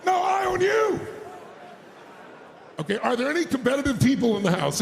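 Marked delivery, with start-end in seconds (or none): 0.0-1.0 high, loud playful shout; booming playful I own you; shouted challenge. Shown as a demonstration. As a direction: throw the challenge back, full force. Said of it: "say it like you mean it".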